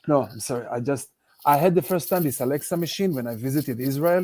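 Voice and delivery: usual speaking voice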